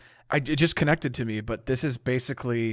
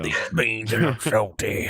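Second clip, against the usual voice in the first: put-on Southern accent. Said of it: "I'm just gonna go with".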